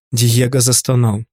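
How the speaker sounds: ordinary delivery, nothing marked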